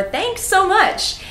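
"so" stressed, high and drawn out; "Thanks so much" with a lifted, slow "so"; emphatic thanks. Shown as a demonstration. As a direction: lean on "so" for emphasis. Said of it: "if you wanna add some emphasis".

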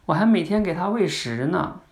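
put-on high child voice; acted young daughter; little daughter. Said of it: "小女儿说".

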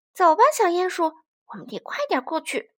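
decisive hedgehog, loud at the start; rally a friend to hurry; high, brisk call settling into firm resolve; unhesitating. A narrator's reading. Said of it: "小刺猬毫不犹豫地说".

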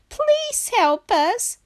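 high, slow, drawn-out plea; frightened begging. Acted. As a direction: beg in a high scared voice. Terrified Duck begs Pig.

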